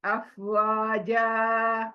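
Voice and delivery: अफवाजा with जा stretched for one beat; long ja. Shown as a demonstration. Teacher shows the stretched जा of अफवाजा. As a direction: hold the जा in अफवाजा. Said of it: "एक आवाज खींचेंगे".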